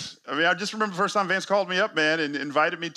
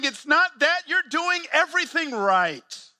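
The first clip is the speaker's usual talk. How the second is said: reassuring Jesus; 10 semitones higher than usual. Pretend. Jesus assures a discouraged church planter, higher and warmly insistent. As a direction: lift it up, reassure them they're on track. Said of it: "And Jesus is saying".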